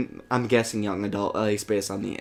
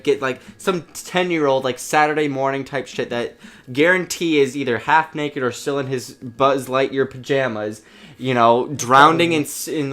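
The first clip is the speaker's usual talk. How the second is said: usual speaking voice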